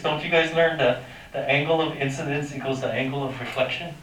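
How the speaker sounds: ordinary talk, nothing marked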